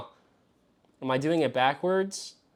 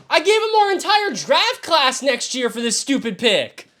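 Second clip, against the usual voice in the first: outraged yelling; 12 semitones higher, 6 dB louder than usual. Real, not acted. Outraged at trade demands.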